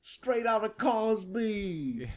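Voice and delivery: slow, high put-on Cosby voice; Cosby impression. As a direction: drawl it out like Cosby. Mock Cosby voice after laughing.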